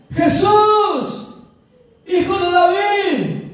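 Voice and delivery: high, loud, long-held shout; clamor más fuerte. Acted. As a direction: cry out with all your might for help. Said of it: "clamaba más fuerte". (grito suplicante a todo pulmón)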